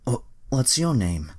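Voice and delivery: high, put-on voice for the man; man asking name. Fat man meets the girl, put-on high voice.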